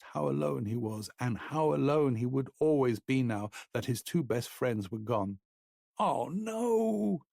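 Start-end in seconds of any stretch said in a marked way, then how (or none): 6.0-7.2 high, drawn-out moan. A narrator's reading. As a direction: moan it out in despair. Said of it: "he moaned again".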